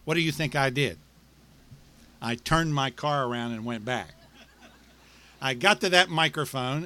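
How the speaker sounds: ordinary delivery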